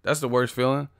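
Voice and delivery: ordinary talk, nothing marked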